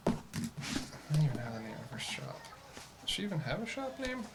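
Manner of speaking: very soft, near-whispered aside; muttering to self. Real, not acted. Player checking notes for a shop name, muttering. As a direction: murmur it under your breath.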